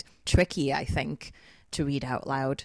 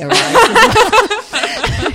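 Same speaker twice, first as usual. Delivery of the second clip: laughing through the words; giggling as she talks; 16 dB louder than usual. Real, not acted. Funny moments, laughter heard.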